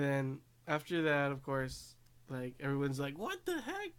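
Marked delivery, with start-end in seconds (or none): 3.2-3.9 very high, squeaked astonishment; mock disbelief. Everyone reacting to the challenge, mock disbelief.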